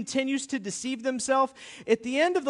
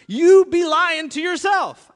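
slow and louder, punching "You be lying to yourself"; slow emphatic declaration; 8 semitones higher, 10 dB louder, slower than usual. Shown as a demonstration. Pastor driving his slogan home to the congregation, slow and loud. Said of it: "I gotta stop talking like that".

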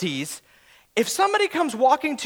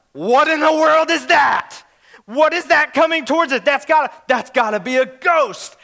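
loud, raised-pitch cry of alarm; mock terror; 3 semitones higher, 4 dB louder than usual. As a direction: act out a panicked outcry, short of a scream. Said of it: "they screamed out in terror".

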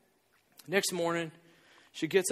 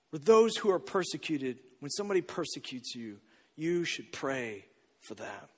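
much softer, lower, slowing to a hush; soft remembered verse; 7 semitones lower, 8 dB quieter than usual. Retold or imitated. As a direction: let the verse fade into a hush. Daughter quoting verse softly.

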